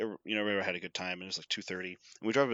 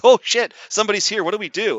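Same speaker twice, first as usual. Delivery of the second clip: higher, louder, fast; mock panic; 7 semitones higher, 8 dB louder, faster than usual. Imagined workers inside panic, fast and high. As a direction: blurt it in a fluster.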